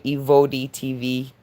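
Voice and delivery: normal talking voice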